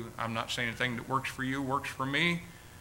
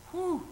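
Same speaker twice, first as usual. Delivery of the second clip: emotional whew; 4 dB quieter than usual. Real, not acted. Emotional moment in prayer.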